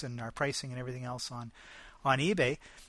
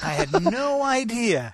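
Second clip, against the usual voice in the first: louder, high, drawn-out astonishment; amazed admission; 9 semitones higher, 7 dB louder than usual. Real, not acted. Host admits to co-host eBay blogs are news, amazed. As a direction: stretch it out in real surprise with a laugh.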